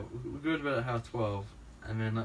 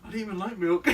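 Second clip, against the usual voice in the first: laughing; 7 semitones higher, 5 dB louder than usual. Retold or imitated. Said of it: "he's like".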